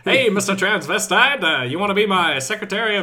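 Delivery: loud, high mock Cincinnati mayor; Cincinnati accent. Mayor Jerry offering a cabinet post, mock Cincinnati accent. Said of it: "Is that, is that Cincinnati accent?".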